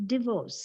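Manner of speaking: divorce starting with short DIH; dih-VORCE for divorce; correct pronunciation. Shown as a demonstration. Teacher pointing out the first sound, divorce as dih-VORCE. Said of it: "D ആണ് first sound".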